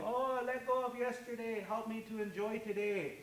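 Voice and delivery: a man praying quietly to release yesterday, soft plea; prayerful plea. Retold or imitated. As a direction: pray it softly, letting go of yesterday. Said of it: "imagine some guy saying".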